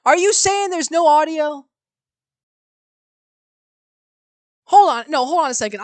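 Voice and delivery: high, loud urgent outburst; alarmed shout. Real, not acted. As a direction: stop everyone, alarmed. Streamer alarmed by no audio.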